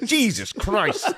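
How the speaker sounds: hammed-up dad shock; mock shocked dad. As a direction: stretch it out in disgusted shock. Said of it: "My dad was like".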